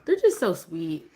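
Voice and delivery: rising to a very high gushing squeal; gushing mom. Retold or imitated. Mom gushing about her baby's kisses. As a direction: melt over the baby. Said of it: "I'm just like".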